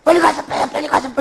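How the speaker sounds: hurried mother begging her son to act; frantic mother. Retold or imitated. Frantic mother urging son to take the knife, excited. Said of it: "방방방방 뛰면서".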